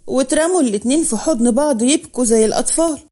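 ordinary delivery, nothing marked

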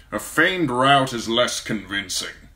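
cold, measured lord; calm, icy authority; Lord Tywin's voice. A narrator's reading.

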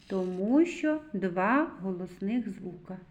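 usual speaking voice

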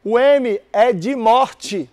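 "morte" laid out slowly word by word; slow emphatic answer. Shown as a demonstration. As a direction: spell the answer out slowly for the class. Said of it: "slow emphatic answer". (professor diz devagar "O M é de morte")

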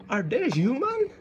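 ordinary delivery

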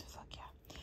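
breathless secret whisper. Real, not acted. Psychic reader whispering to viewers, real quiet glee. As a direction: hush it like a thrilled secret. Excited whisper over spell news.

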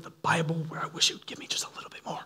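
fast, confiding whisper; a hushed, hurried confession to the congregation; whispered aside. Real, not acted. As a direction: lean in and whisper it quickly.

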